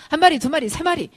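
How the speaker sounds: loud, high exasperated counting; angry older brother. Acted. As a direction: count it out forcefully for your brother. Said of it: "머리 끝까지 화가 납니다". (화나서 큰 소리로 세어 보이듯)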